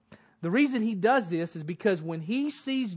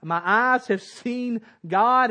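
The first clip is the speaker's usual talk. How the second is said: slow loud proclamation; 4 semitones higher, 6 dB louder, slower than usual. Acted. Isaiah's confession, preached.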